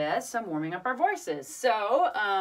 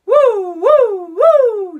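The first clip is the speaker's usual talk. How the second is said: loud, raised-pitch woo pushes; medium voice; 11 semitones higher, 18 dB louder, much slower than usual. Shown as a demonstration. Teacher goes first on pushes, medium voice. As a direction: push each woo out well above speaking pitch. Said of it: "let's do a medium voice".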